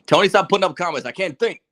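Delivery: high, loud, rushed complaint; exasperated plea. Real, not acted. Host begging producer to stop distracting him, exasperated and high.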